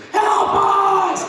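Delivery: shouted high plea for help; desperate cry. Retold or imitated. Pleading to God, shouting high for help. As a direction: cry out to be rescued.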